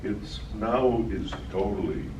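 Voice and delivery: ordinary talk, nothing marked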